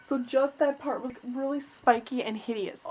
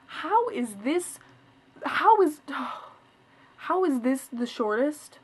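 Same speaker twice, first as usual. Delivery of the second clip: slow, higher, incredulous; exasperated disbelief; 3 semitones higher, 4 dB louder, slower than usual. Real, not acted. Showing viewers her butchered bangs, slow and incredulous. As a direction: drag out the disbelief, sighing.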